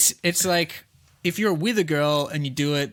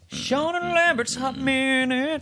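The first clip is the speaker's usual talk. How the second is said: announcer voice; 10 semitones higher than usual. Real, not acted. Show intro announcement.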